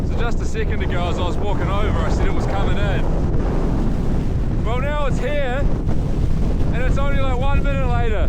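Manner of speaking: high, projected voice over the storm. Real, not acted. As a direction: call it out over the wind and snow. Reporting outside in a blizzard.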